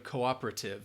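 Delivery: "cooperative" with a fuller O in the first syllable; British-style O sound. Shown as a demonstration. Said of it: "might say that O sound more".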